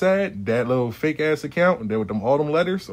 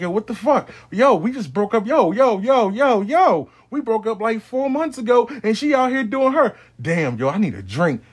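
high, loud, frantic disbelief; angry ex losing it over a picture; snapping in shock; 8 semitones higher, 4 dB louder than usual. Retold or imitated. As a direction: blow up at the photo, yelling yo over and over. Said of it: "He snapping".

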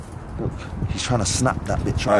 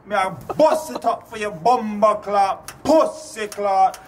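mock Jamaican patois slang, slowing at the end; Jamaican patois accent; 10 semitones higher than usual. Accent challenge number three, a hammed-up Jamaican patois voice. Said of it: "Right, number three, go".

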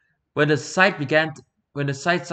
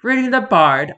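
higher, louder, disbelieving echo; incredulous; 7 semitones higher, 6 dB louder than usual. Acted. Lord echoing "Reading the Bard?" in loud incredulous surprise. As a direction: throw the phrase back in disbelief. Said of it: "A waste? You jest".

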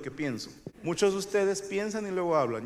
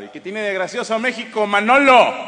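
building to a loud, higher-pitched yell; mock angry confrontation; 3 semitones higher, 9 dB louder than usual. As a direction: confront him, exploding on the name.

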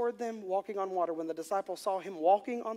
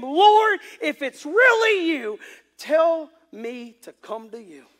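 calling out; 9 semitones higher, 14 dB louder, much slower than usual. Acted. Preacher acts Peter calling to Jesus on the water, calling out. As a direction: holler it across the water, slowing at the end. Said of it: "Then Peter called to him".

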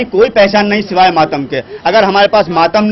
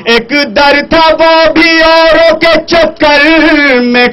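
stately nazm recitation; 3 semitones higher than usual. Shown as a demonstration. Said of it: "پیش کرتا ہوں چار مصرے".

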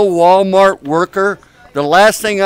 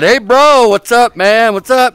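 raised, higher-pitched buddy greeting; imitated yelling; 6 semitones higher, 5 dB louder than usual. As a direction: imitate someone hollering to a pal. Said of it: "it's like they're yelling out".

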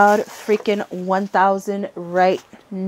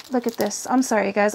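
usual speaking voice

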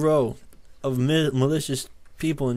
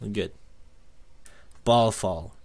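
ordinary talk

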